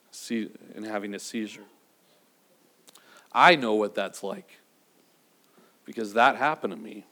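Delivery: low, hushed and emotional; choked up. Real, not acted. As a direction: let the memory catch in your throat. Preacher recalls his child's seizure.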